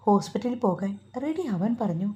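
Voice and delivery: usual speaking voice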